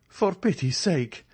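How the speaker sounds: high, slow, frightened plea; bewildered cry. A narrator's reading. Andrea begs the startled ladies, a frightened high plea. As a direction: beg for pity, voice climbing in alarm. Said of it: "he cried, pale and bewildered".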